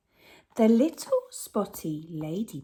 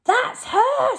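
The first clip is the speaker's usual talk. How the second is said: much higher and louder, a squeaky robber; robber Hugh; 7 dB louder than usual. Acted. Robber Hugh spots the singing whale, high and loud. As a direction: pipe it up like an eager crook. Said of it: "said Hugh".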